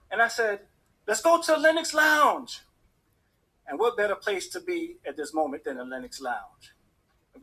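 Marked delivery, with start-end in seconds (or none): 1.1-2.6 loud, high, drawn-out call; elated suggestion. Retold or imitated. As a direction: shout it like a party rallying cry. Elated suggestion on election night.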